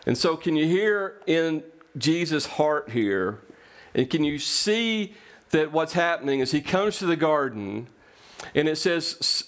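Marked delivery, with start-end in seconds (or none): none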